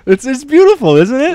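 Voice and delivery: high, loud gushing. Real, not acted. Insisting to her friend the ending is lovely, loud gushing.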